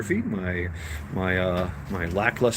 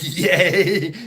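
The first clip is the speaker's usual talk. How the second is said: retold yay; 5 semitones higher, 6 dB louder than usual. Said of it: "he's just like".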